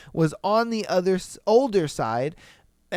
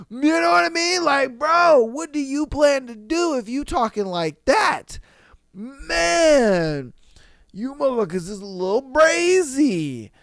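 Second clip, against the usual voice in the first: high, loud incredulous rant; outraged; 7 semitones higher, 4 dB louder than usual. Real, not acted. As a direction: yell it in disbelief.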